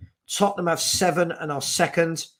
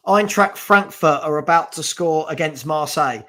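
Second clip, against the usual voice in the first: normal talking voice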